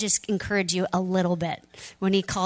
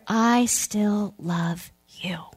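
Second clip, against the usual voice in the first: slow, whispered I still love you; tender whisper; much slower than usual. Retold or imitated. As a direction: breathe the words gently, slowly. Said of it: "Jesus says".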